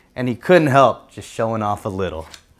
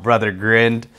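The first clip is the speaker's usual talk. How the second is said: ordinary delivery, nothing marked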